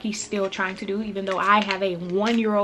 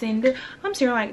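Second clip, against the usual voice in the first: ordinary talk, nothing marked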